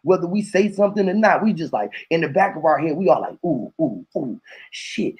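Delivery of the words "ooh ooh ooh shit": mock hushed reaction. Imitating inner reaction in heads.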